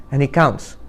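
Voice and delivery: usual speaking voice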